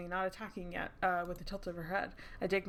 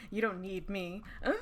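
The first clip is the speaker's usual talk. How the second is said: ordinary delivery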